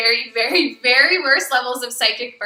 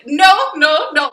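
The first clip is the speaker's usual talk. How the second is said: emphatic no; 4 semitones higher, 6 dB louder, slower than usual. Retold or imitated. Emphatic refusal.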